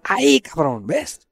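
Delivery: high, excited "Ahí, cabrón" with a sharp rise on "¿ves?"; mock triumphant yell. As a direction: shout it like he has finally found it. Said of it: "Y ahí me grita".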